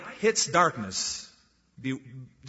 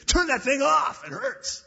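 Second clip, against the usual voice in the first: much higher, a whiny pained protest; pained complaint; 12 semitones higher, slower than usual. Pretend. Man blinded by the light after darkness, high pained whine. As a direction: whine it like the light stings your eyes.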